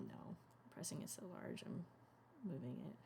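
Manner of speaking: voiceless mutter to self; whispering. Real, not acted. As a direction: whisper it while working. Muttering while editing.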